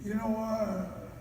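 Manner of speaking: put-on slurring, slow drunk homie on the phone; drunk caller. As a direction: lazily slur the words like a drunk friend.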